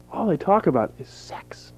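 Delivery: hushed conspiratorial whisper. Acted. Comedian confiding to the audience in a whisper. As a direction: lean in and whisper it like a secret.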